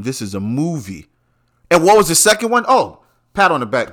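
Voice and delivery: normal talking voice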